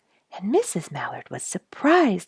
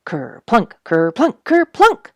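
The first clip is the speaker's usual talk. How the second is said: each ker-plunk louder and higher; building splashes; 5 semitones higher, 9 dB louder, slower than usual. A narrator's reading. Reading to children, splashes building to loud high. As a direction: climb to a big splashy finish. Otters splash one after another.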